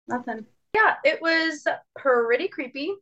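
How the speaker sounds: ordinary talk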